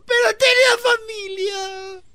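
high, slow whining sob; imitating a crying child. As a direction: mimic the boy's crying wail.

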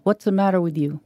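ordinary delivery

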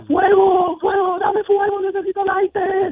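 high, shrieking cry for fire; frantic yell. Acted. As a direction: scream it like a desperate stoner.

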